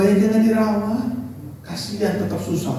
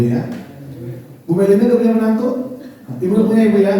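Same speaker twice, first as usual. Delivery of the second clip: usual speaking voice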